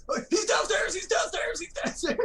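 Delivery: urgent callout. Retold or imitated. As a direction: teammates yelling my position. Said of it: "they go".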